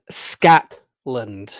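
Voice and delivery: "Scotland" drawn out as "Scat... land" with an "a"; wrong pronunciation. Shown as a demonstration. Vlogger mocking the mispronounced "Scotland", slow. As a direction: stretch out the wrong "Scatland" for "Scotland". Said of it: "There is no such fucking place".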